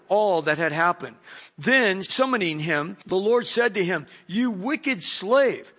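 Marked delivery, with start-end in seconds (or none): 4.3-5.7 slow rebuke. Acted. Lord rebukes slave in parable.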